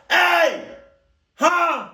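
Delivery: high, loud yelled call. Pretend. Yelling through the closed window at the stranger, high and loud. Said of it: "Just yell".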